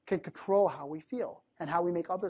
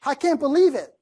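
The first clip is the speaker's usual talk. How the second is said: raised, louder acted outrage; really upset; 6 semitones higher, 5 dB louder than usual. Upset person venting to friends, hammed-up anger. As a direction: heave it out high and forceful, overdramatized. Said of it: "when you're really upset".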